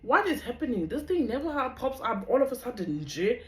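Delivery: high, loud bewildered cry; baffled exclaim. Retold or imitated. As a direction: shout in confusion at what keeps popping up. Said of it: "I'm like".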